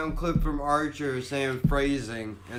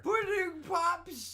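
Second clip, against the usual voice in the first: high, drawn-out comedian impression; hammed-up celebrity impression; Bill Cosby; 13 semitones higher, 5 dB quieter, much slower than usual. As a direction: pitch it way up and stretch the words. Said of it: "I'm still Cosbying".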